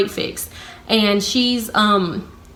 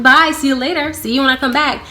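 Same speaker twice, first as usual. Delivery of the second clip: higher-pitched sing-out farewell; pointed cheery goodbye; 5 semitones higher than usual. Retold or imitated. Walking off on break past coworkers, pointedly cheery. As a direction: show off that you're leaving.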